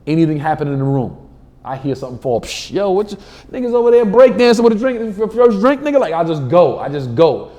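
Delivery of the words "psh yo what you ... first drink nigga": high, loud crowd-work voice; on-stage riffing. Acted. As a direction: pounce on the audience with excited ribbing. Acts out riffing at audience.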